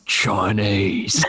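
gruff Statham voice on one word. Shown as a demonstration. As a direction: say it like Jason Statham. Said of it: "So I just do".

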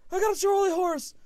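high, loud cry of pain. Retold or imitated. Husband acting out wife's leg cramp, pained yell. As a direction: imitate someone yelling through a cramp.

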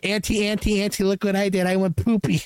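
put-on high-pitched toddler bragging to auntie; hammed-up childish announcement; mock little child. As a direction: put on a proud little-kid voice. Plays small child to aunt.

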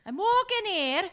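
high-pitched mock Scottish accent. Player retrying the film quote, high Scottish accent. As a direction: push the Scottish brogue way up high. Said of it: "your accent is Scottish".

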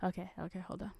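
ordinary talk, nothing marked

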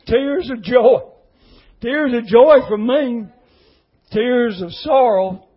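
loud, high, heartfelt cry of tears of joy; emotional outburst. Real, not acted. Overcome retelling two men crying.

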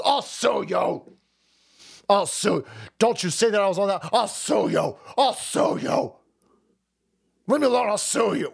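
mock a celebrity wailing empty threats; Jimmy Kimmel. Host mocking a talk show comedian who threatens to sue, hammed-up protest. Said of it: "Jimmy Kimmel says".

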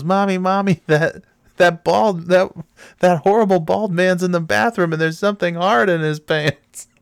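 high, mock-alarmed child voice; little girl yelling. Retelling a girl tattling to her mother, high mock yell. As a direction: imitate a little girl crying out to mommy. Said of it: "the girl runs out and yells".

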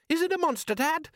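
very high, squeaky panic; terrified boy piping up; frightened son. Acted. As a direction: squeal the question to Dad. Son frightened of rising thing.

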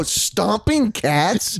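retold as a slow, rising "you can't be serious"; incredulous echo. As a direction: stretch out each word in baffled question. Retelling disbelief at friend's fear.

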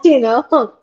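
laughing. Real, not acted. Laughs at own uncertainty.

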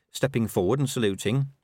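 usual speaking voice

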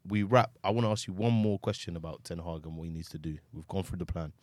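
usual speaking voice

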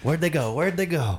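high, sing-song where'd they go; playful high call. Real, not acted. Playful calling out.

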